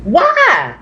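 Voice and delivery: high, bewildered what and why; stunned disbelief at being insulted; baffled what why. Real, not acted. Baffled by abuse from online gamers, bewildered.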